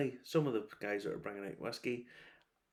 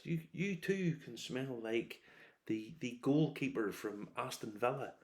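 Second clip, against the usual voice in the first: slow, drawn-out spoof of an advert voice; mock perfume ad; 3 semitones higher, slower than usual. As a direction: ham up a cheesy ad pitch, slowly. Mocking celebrity perfume ads.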